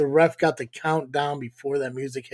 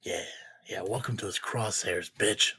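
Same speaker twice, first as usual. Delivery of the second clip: whispered taunt; 7 semitones lower, 9 dB quieter than usual. Acted. Menacing taunt to rival.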